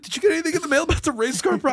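put-on a panicked kid rattling off a question; nervous kid calling mom about homework; frantic, rushed, high voice. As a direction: race the words out in a high panic.

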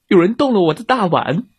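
high, mildly loud complaint; loud papa bear. A narrator's reading. Papa bear finds his bowl touched, mildly loud. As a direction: raise your voice a little, indignant bear. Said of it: "熊爸爸大声说".

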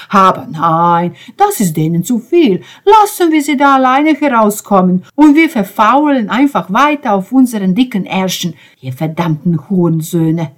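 loud, high, sarcastic fury, easing on the curse; crescendo tirade. A narrator's reading. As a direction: build into a bitter rant. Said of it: "dem crescendo schlagenden Ben".

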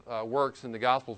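usual speaking voice

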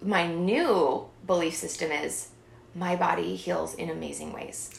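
usual speaking voice

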